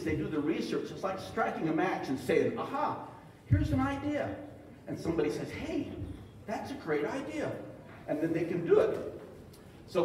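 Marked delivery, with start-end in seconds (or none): 2.6-4.3 high-pitched acted moment of discovery; light up with a sudden idea, pitched high; acted eureka. 5.5-7.5 acted enthusiasm. Acts out someone approving the idea.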